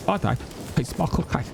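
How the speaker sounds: emotional Cockney film-star impression; Michael Caine. Streamer showing the actor getting emotional, halting Cockney impression. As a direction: choke up slightly in halting Cockney. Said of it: "when he gets emotional".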